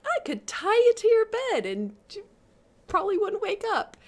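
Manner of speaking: high, loud mock boast; playful teasing. She teases the sleeper in bed, playful and high. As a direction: swoop up in exaggerated teasing.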